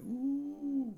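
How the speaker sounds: high surprised ooh; realization. Retold or imitated. Man caught out by his own answer, surprised and high. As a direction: let it dawn on you, high. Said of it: "I was like".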